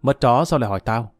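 usual speaking voice

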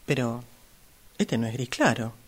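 soft, low grumble to herself; muttering. Acted. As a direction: complain under your breath. Customer grumbling in the fitting room. (refunfuña en voz baja para sí)

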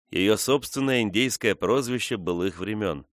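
plain reading voice

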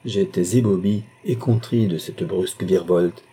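ordinary delivery

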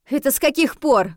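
astonished challenge; taken aback. A narrator's reading. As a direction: ask it stunned and indignant. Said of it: "опешила я".

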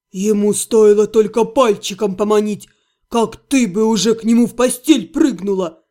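low, reproachful male voice; grumpy half-gnome. A narrator's reading. Otto scolding the heroine about flirting, low reproach. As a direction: lecture her like a jealous friend.

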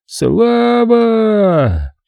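high, long-drawn cheer; crowd's roar. A narrator's reading. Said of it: "Толпа взревела".

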